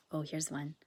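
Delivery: ordinary talk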